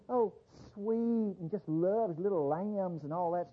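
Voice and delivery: slow, sugary mock tone; mocking sweetness. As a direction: lightly parody a sentimental view. Mocking a soft image of Jesus.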